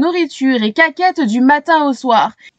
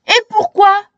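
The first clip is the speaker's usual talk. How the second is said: higher, louder demanding cry; crowd shouting; 8 semitones higher, 4 dB louder than usual. A narrator's reading. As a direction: crowd shouts back at the girl. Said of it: "crient-ils".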